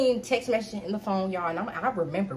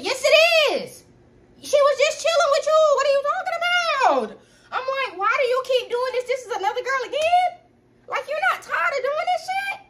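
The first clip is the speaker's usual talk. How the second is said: very high, loud accusing voice; high outraged yelling; 5 dB louder than usual. Retold or imitated. Girlfriend shrieking at boyfriend caught cheating, high and loud. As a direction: shriek your disbelief at him.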